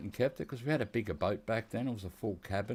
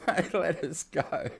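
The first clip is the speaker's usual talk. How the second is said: laughing through the words, slow; real laugh at the story's end; 8 semitones higher, 3 dB louder, slower than usual. Real, not acted. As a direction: crack up at getting away with it. Punchline of the cops story.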